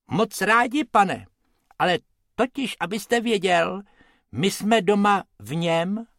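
high-pitched little rabbit voice; small, high character explaining himself; Bobek. A narrator's reading. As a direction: squeak it politely like a small cartoon rabbit. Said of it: "Bobek ukázal na klobouk a řekl".

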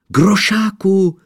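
high, loud call across a clearing; calling the horse. A narrator's reading. Boy calls out for horse. (hlasité volání na koně)

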